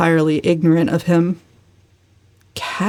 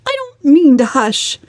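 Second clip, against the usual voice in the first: higher and louder, defiant refusal; perverse; 9 semitones higher, 4 dB louder than usual. A narrator's reading. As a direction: throw it back at them defiantly. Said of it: "said Valancy perversely".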